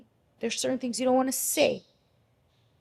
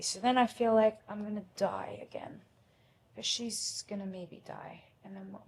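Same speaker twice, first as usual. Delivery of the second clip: softer, unvoiced despairing whisper; fearful whisper; 6 dB quieter than usual. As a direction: whisper it as the dread returns. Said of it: "You want me to act it out?".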